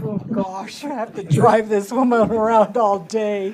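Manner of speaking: loud, playful imitation of reluctance; mock unhappy camper. Councilor imitating the officer stuck driving her, mock dismay. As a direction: build to a loud, hammed-up grumble. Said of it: "he did not look like a happy camper".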